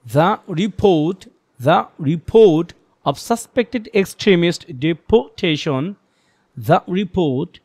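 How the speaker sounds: very slow, with a breath pause after "The report"; phrase by phrase. Shown as a demonstration. Teacher demonstrates chunked reading to the class, very slow. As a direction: read "The report" in slow chunks, pausing between them. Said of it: "একটা step পরে একটু দম নিচ্ছি".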